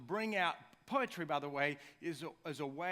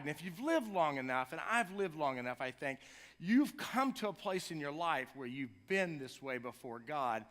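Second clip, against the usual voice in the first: ordinary talk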